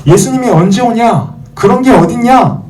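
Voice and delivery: loud-edged, high-pitched jeering challenge; high, derisive questioning; mocking scoffer. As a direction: throw it out as a scornful taunt. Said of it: "이렇게 막 놀리거나".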